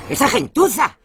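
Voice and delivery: high-pitched scornful outburst; scandalised mother exclaiming; outraged contempt. Acted. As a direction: spit it out in disgust, voice leaping. Mother outraged at magazine's source.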